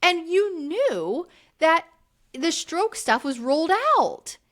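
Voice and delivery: mock outraged Brittany. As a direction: throw the accusation back at him, voice climbing high. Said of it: "and she's like".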